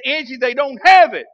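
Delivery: husband frustrated on the phone to his wife. Retold or imitated. Husband phoning his wife from the store, mock frustrated. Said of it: "call her all frustrated".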